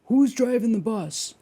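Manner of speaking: loud, high challenge to the room; high, punchy motivational presenter; presenter's rousing opener. Retold or imitated. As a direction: throw it out like a fired-up seminar speaker. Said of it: "open his course with".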